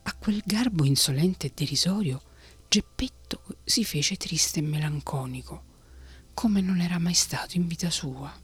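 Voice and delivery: ordinary delivery, nothing marked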